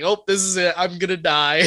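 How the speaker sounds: retold alarm. Telling friends about nearly falling off the roof, mock alarm. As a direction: play the near fall as a mild panic. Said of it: "I was like".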